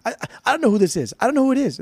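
high, rushed, irritated retelling; imitated aggressive wife. Wife complaining to David off the phone, imitated aggressive. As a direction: pitch it up in mock exasperation. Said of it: "getting aggressive with him".

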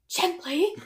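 high plea rising at the end; mock-pained little whimper; pained hero. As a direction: wince it out after an "oh". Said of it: "and he's like".

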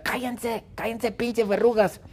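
high, sharp mock yell; yelling at the warts. Poor Stewie yelling at his warts, high mock shout. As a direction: snap at them to shut up, way up high. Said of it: "El pobre Stewie les grita".

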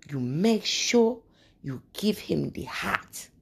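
slow, earnest African auntie advice; lightly voiced African elder giving advice; African accent. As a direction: pass on a secret recipe with an African lilt. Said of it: "That's what she said".

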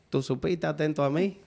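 usual speaking voice

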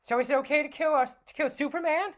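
high, bright sidekick voice; Robin voice. Acted. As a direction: play the eager young sidekick.

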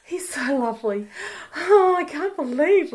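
mock swooning over her boyfriend, high; gushing in a high voice. Describing her radio crush, gushing high. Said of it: "I just was sitting here going".

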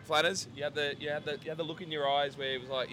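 usual speaking voice

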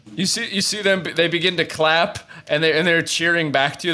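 ordinary talk

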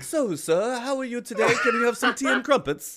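playful imitation of a formal British English accent; mock British accent. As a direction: lay on a jokey British accent over tea. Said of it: "like, formal English".

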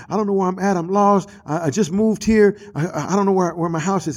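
mock tearful. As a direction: lightly imitate a kid stammering through tears. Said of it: "trying to cry and say".

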